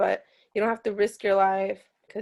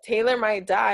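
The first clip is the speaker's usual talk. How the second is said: ordinary delivery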